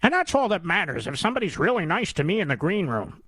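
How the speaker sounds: mock gushing, swooping up and down; mocking imitation. Mimicking those charmed in the green room, mocking gush. Mimics people excusing the anchor.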